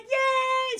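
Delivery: put-on very high, loud squeal of excited children; kids cheering. Children spotting Santa in the chimney, squealing. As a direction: squeal like a delighted little kid.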